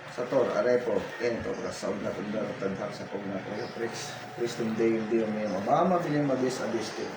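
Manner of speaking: very low, hushed ritual prayer; soft droning chant, fading away; murmured incantation. Real, not acted. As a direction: murmur a secret spell under your breath.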